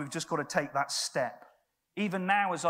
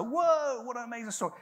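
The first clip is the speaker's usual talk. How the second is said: mock amazement; 5 semitones higher than usual. Mock imagined amazed reaction.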